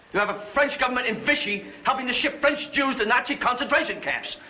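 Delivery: high, hard, angry dressing-down; furious tirade. Acted. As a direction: tear into him without letting up. Ike furiously berates General Giraud.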